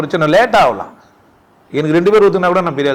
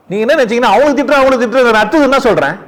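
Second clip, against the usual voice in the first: raised, forceful sermon voice, high and loud; fiery preaching; 8 semitones higher, 3 dB louder than usual. Acted. Preacher rebuking listeners about false saints, forceful loud preaching. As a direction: hammer the point home to the crowd.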